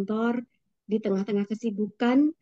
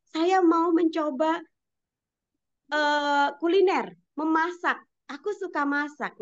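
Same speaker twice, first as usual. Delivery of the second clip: higher voice quoting a student; 6 semitones higher than usual. Retold or imitated. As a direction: voice the pupil, pitched up. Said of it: "Ananda Zidni di rumah itu mengatakan bahwa".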